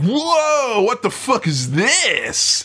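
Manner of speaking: high, drawn-out gawping wonder; mock astonishment. Imitating the film gawping at travellers, mock astonishment. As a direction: gawp at a spectacular oddity. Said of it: "like, a spectacular oddity".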